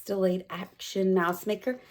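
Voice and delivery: plain reading voice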